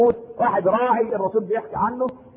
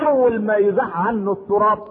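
ordinary talk

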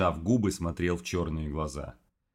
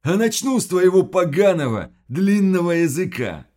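loud, higher monster menace; menacing threat; 12 semitones higher, 10 dB louder than usual. Acted. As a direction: threaten him hard.

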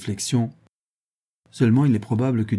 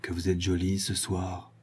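much softer, lower murmur; 3 semitones lower, 8 dB quieter than usual. A narrator's reading. Guibray to the marquise over coffee, very low. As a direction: drop it to a murmur only she hears. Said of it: "lui dit très bas".

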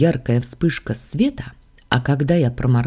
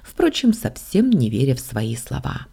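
usual speaking voice

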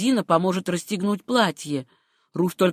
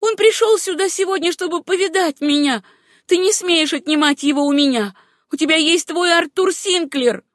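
high, heated protest; impassioned, jealous outburst; furious quarrel; 9 semitones higher, 4 dB louder than usual. A narrator's reading. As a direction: claim him angrily from a rival. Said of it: "дрожа от бешенства".

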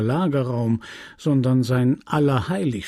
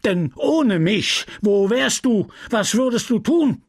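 raised, breathless scolding; apothecary scolding his ungrateful apprentice; panting rant; 8 semitones higher than usual. Acted. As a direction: pant out the reproaches. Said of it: "redete weiter in hechelnden Sätzen".

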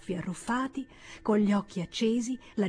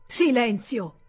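loud, sharp call for silence; thundering command; 4 semitones higher, 7 dB louder, slower than usual. Acted. The senator silencing his brother with a thundering shout. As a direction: cut your brother off with a thundered order. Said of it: "tuonò il senatore Buddenbrook".

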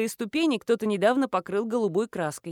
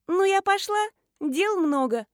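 put-on high, breezy girlish voice; teenage girl; 9 semitones higher, 4 dB louder than usual. A narrator's reading. Schoolgirl excuses herself after taking money, breezy girlish voice. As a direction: sign off like a busy kid. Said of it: "школьница".

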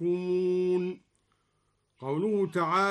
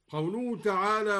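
ordinary talk, nothing marked

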